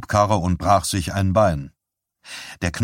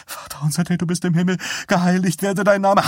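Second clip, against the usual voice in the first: desperate praying; 10 semitones higher, faster than usual. A narrator's reading. Wife praying to shut out husband's tirade, desperate recital. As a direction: pray over the shouting in a high voice. Wife prays against his shouting.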